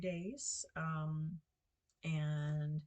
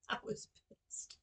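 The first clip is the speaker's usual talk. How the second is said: laughing exasperation; 3 semitones higher, 7 dB louder than usual. Real, not acted. Laughing about the ruined sink.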